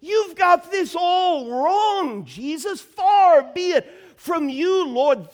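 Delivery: loud, high protest at the start; rebuking. Acted. Peter rebuking Jesus, loud high protest. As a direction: scold him as if he has it all wrong. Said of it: "he begins to rebuke him".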